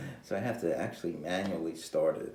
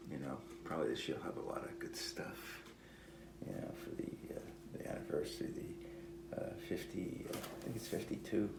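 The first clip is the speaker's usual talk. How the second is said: whispered aside; 5 semitones lower, 9 dB quieter than usual. Real, not acted. Drops into murmured aside.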